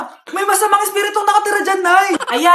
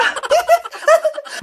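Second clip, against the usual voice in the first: tickled giggle; 10 semitones higher than usual. Acted.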